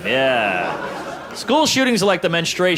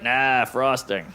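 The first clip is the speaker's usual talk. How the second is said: slow deadpan; 4 semitones lower, much slower than usual. Retold or imitated. Deadpan punchline.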